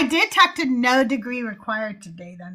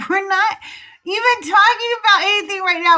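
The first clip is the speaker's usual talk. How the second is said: exasperated retort; 6 semitones higher, faster than usual. Retold or imitated. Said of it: "And I'm like".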